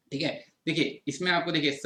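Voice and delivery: ordinary talk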